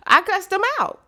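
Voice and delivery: high, punchy boast; cussed them out. Retold or imitated. Telling the audience how he answered his job. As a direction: toss it off high and proud.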